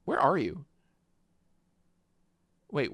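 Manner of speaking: usual speaking voice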